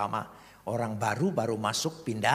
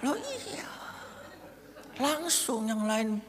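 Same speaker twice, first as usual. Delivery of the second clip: much higher and louder, slow and delighted; laughing preaching; 13 semitones higher, 7 dB louder, slower than usual. Acted. Preacher telling the congregation to enjoy hardship, laughing. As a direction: preach it with joy breaking through.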